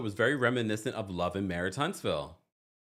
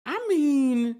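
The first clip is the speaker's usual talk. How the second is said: much higher exclaimed start; 13 semitones higher, 5 dB louder than usual. Real, not acted. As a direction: launch it up high. High exclaim before serious point.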